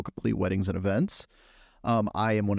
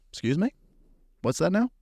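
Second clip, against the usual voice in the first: higher-pitched, questioning double take; mock-incredulous, pitched up; retold disbelief; 9 semitones higher than usual. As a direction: act out being taken aback by an accusation. Retold reaction to being accused of stealing.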